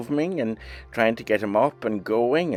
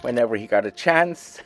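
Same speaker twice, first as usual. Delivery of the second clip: ordinary talk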